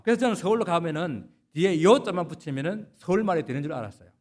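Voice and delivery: ordinary delivery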